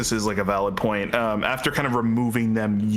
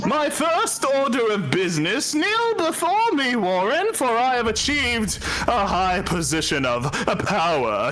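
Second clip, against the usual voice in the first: high pompous tyrant voice; dark lord; 11 semitones higher than usual. Acted. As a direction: demand they kneel, grandly. Character demands kneeling.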